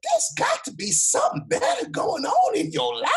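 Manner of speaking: very high imitated Southern lady; Southern country drawl. Old lady counselling a frustrated student, mock Southern drawl. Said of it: "this is Southern country drool kind of talk".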